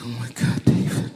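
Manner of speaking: breathy, voiceless tearful gasp; acted sob, barely voiced; imitated crying. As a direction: imitate a wife weeping out the words. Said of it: "And she's crying".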